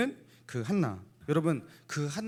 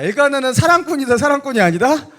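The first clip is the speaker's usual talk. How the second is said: playful quiz; 11 semitones higher, 12 dB louder than usual. Acted.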